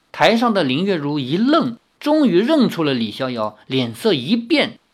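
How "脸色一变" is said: ordinary delivery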